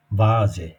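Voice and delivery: "বাজে" begun with a voiced V, Z in the middle; foreign-word V sound. Shown as a demonstration. Teacher shows foreign-word rule, voiced V sound. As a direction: say "বাজে" as vah-zeh, not with F. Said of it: "বয়ের মতন উচ্চারণ হবে".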